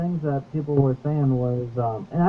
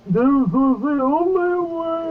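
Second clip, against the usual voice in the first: much higher, slow, weighty line reading; grave, solemn Strange; 12 semitones higher, slower than usual. Retold or imitated. A friend acts out Doctor Strange, slow and solemn. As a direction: deliver it like a fated decree. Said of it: "He didn't say it as friends".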